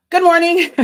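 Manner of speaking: loud, high cheery good morning; bright greeting. Real, not acted. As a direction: greet the viewers with a burst of cheer. Cheerful live stream greeting.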